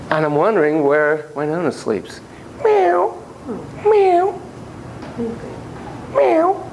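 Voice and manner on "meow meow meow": high toy kitty meow; cat meow. Pretend. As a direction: mew like a little cat.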